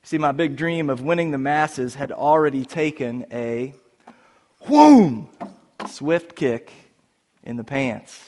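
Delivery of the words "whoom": loud high mock whoosh; playful whoom; whoosh sound effect. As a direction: throw in the swooping kick noise. Sound effect of the kick again.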